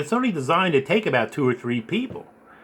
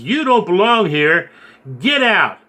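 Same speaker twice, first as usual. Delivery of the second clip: louder, slow mock threat; retelling a mean man telling him to leave, louder and slow; 5 semitones higher, 5 dB louder, slower than usual. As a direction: play the hostile stranger warning him off. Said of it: "he was mean and ugly".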